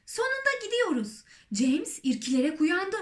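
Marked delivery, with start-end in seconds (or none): 0.1-1.2 high, excited call without extra volume; excited cry. A narrator's reading. Someone rejoicing at finally leaving, excited cry. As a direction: cry out high at long last. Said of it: "diye bağırdı biri".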